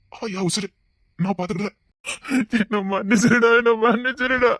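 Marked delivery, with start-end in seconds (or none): none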